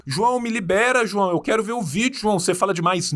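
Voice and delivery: high, pleading complaint from a viewer; whiny viewer voice. Retold or imitated. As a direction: whine like an impatient fan. Voicing impatient viewers.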